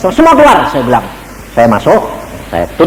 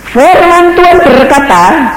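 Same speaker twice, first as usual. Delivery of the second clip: high, slow proclaiming; solemn preacher's call; preaching proclamation; 4 dB louder, slower than usual. Acted. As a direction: announce the word of God slowly.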